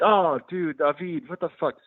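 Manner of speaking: high, softened groan of disbelief; mock grossed-out dismay. Friends reacting to goat soup, in mock dismay.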